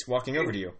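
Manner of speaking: ordinary delivery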